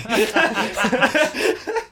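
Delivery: a big high burst of laughter; loud laugh. Real, not acted. Player cracking up at the table, loud laugh.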